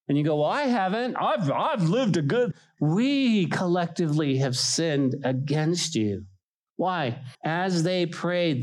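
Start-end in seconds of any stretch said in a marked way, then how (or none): 0.4-2.5 mock self-righteous protest. Self-righteous objection voiced.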